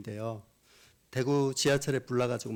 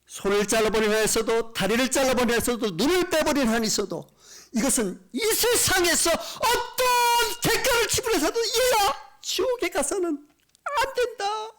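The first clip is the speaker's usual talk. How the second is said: God crying out; 6 dB louder than usual. Retold or imitated. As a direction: plead at full force, slowing as you cry out. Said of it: "하나님 그렇게 외치는 거잖아요". (울부짖듯 길고 높게 외치는 소리)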